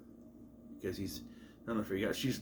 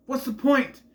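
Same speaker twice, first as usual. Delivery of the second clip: normal talking voice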